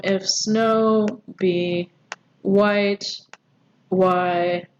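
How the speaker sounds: very slow, words set apart by pauses; deliberate metrical reading; slow verse scansion. Shown as a demonstration. Scanning the verse syllable by syllable.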